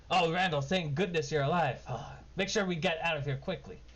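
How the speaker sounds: grateful halfling urging a fast escape; relieved halfling. Acted. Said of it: "he goes".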